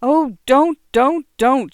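high, loud tearful pleading; wife crying out to her husband; crying plea. A narrator's reading. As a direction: cry out to make him stop. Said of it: "she cried".

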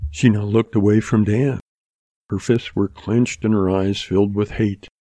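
usual speaking voice

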